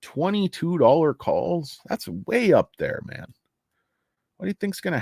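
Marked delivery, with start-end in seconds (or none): 0.0-3.3 "Twenty-two dollar calls?" slow and higher in disbelief. Real, not acted. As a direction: drag out "Twenty-two" incredulously. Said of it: "I can't believe people are buying this shit".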